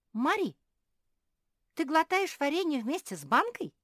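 high, loud incredulous question; stunned amazement. A narrator's reading. Чинг asks Мари about swallowing jars, dumbfounded. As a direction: ask it aghast, voice shooting up. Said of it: "обомлел Чинг".

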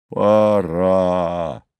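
drawn-out, falling «Пора»; a touch of an old giant settling down; sleepy agreement. A narrator's reading. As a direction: agree slowly, as if dozing off.

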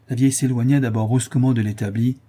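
ordinary delivery, nothing marked

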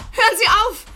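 loud panicked cry to stop; frightened shout. Acted. Woman alone orders intruder to stop, terrified shout. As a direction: scream at him to stop.

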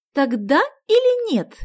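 high, loud demand for an answer; pressing. A narrator's reading. Sonya cornering Vasya for an answer, insistent. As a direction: push hard for a yes or no. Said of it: "напирала она".

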